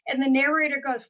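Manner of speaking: usual speaking voice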